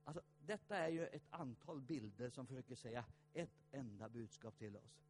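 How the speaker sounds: normal talking voice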